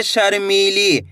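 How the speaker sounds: शर्मीली stretched out slowly; slow model word. Shown as a demonstration. Teacher modelling how to read the word. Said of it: "कैसे पढ़ेंगे?".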